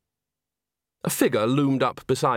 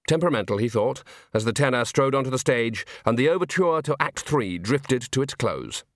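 plain reading voice

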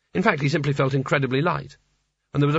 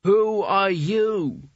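very slow, higher, hollow voice of the dead; ghostly chorus; 6 semitones higher, much slower than usual. Acted. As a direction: speak as two hundred dead mages at once. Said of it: "he saw two hundred pairs of pale lips move".

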